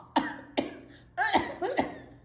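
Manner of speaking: high, hammed-up cough; fake cough. Lady in the row in front breaking the hush, with a hammed-up cough.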